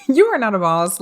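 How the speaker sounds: said through a laugh, loud. Retold or imitated. Repeating the putdown to the ex, laughing.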